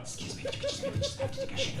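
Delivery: high squeaky pleading little voice; mock dog voice. Dog asking the passenger, in a high squeaky voice. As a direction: play the anxious dog asking to go. Comic voicing a dog's plea.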